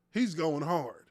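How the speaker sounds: normal talking voice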